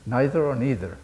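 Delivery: "Neither or neither" as NY-ther or NEE-ther; first "Neither" with an eye vowel, second "neither" with an ee vowel; two pronunciations contrasted. Shown as a demonstration. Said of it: "how do you say it in American English?".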